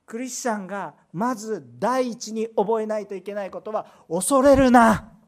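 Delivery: loud, high, slow pulpit cry; fiery sermon delivery; forceful preaching. Acted. Pastor preaching at full force.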